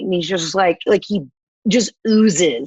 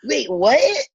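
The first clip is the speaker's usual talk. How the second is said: higher, startled mock double take; mock surprise; 7 semitones higher than usual. Money guy spotting the paycheck, mock surprised. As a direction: act caught off guard by money. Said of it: "So he was like".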